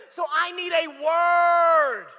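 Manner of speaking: slow, loud, drawn-out declaration; preaching shout. Acted. Preacher to the congregation, a slow loud declaration. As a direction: drive the point home, slowly.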